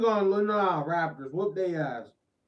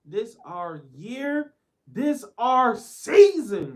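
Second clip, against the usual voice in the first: slow, high, loud hype roar; hype shout; 10 semitones higher, 18 dB louder, much slower than usual. Real, not acted. Streamer roaring the season chant to his chat, slow and loud. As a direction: belt it like a stadium chant.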